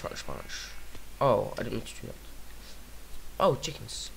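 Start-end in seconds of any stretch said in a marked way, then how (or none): 0.0-0.7 whispered punches. Acted. Punch chant fading.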